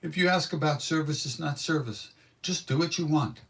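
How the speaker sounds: mock-sour guru laying down the rule; dismissive guru. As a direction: brush the question off impatiently. Translates guru's sour rebuke.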